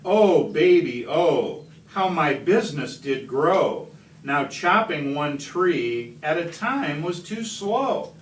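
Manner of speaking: usual speaking voice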